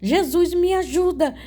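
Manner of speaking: high, reenacted plea to Jesus; crying out for help. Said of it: "comecei a clamar por Jesus". (clamor agudo de socorro, encenado)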